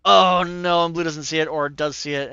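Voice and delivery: loud alarmed outburst; alarmed shout. Real, not acted. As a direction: shout in shocked dismay. Sudden alarm at a game move.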